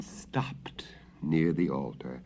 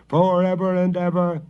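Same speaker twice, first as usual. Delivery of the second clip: high, louder fearful echo; quavering flock; 11 semitones higher, 7 dB louder than usual. A narrator's reading. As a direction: repeat it in trembling unison. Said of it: "quavered his flock".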